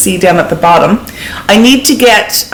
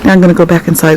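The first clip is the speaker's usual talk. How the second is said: ordinary talk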